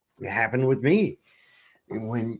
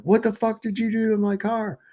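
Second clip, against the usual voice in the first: yelling at the cops; angry yell at police; retold yelling; 9 semitones higher than usual. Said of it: "I was yelling at the cops".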